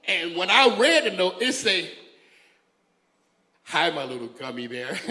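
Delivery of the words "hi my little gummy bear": low, soft sweet-talk; hammed-up seductive pet-name voice; mock flirty. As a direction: coo it low, imitating a lover's note. Reads partner's flirty love note aloud.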